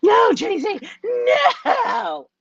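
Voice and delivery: high wailing no, half sobbed, half shouted; mock crying protest. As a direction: imitate a panicked client crying out no. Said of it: "they're like".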